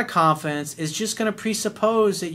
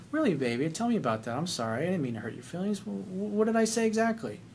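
soft caring reply; 3 semitones higher, 6 dB quieter than usual. Retold or imitated. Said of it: "voicing his caring reply".

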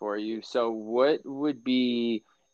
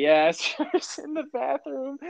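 mock mom voice; 13 semitones higher, slower than usual. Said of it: "Your mom came back and was like".

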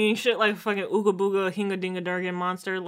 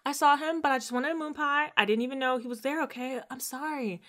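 put-on pleading girlfriend; 7 semitones higher than usual. Voicing Keyshawn confessing.

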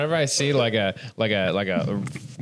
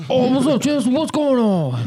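Doc impression; 12 semitones higher, 5 dB louder than usual. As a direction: greet Chelsea in a squeaky, stretched voice. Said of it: "Doc's voice is, like, very unique".

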